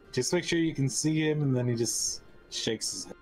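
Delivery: usual speaking voice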